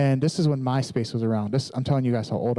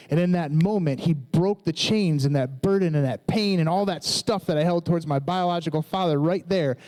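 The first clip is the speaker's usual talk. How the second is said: raised, fervent preaching voice; 5 semitones higher than usual. Acted. Preacher telling the congregation how God freed him, fervent. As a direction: build the testimony up with fervour.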